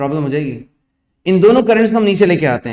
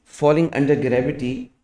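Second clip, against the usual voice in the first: slow, word by word dictation; dictating; 3 semitones lower, much slower than usual. Acted. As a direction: dictate slowly for students to write. Dictation repeated.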